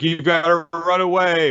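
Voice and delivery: mock-frantic warning; urgent plea. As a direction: push them to run. Hero urges siblings to flee.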